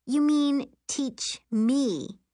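slow, high, pointed correction; snide. A narrator's reading. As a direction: drag the words out snidely. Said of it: "Miri said snidely".

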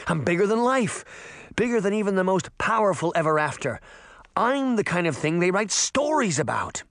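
put-on high, power-drunk boasting; elated, voice lifted throughout; exultant. A narrator's reading. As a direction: revel in the new power. Jake exults in new power.

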